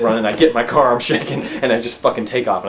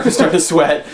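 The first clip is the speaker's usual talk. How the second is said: laughing; 9 semitones higher, 11 dB louder than usual. Real, not acted.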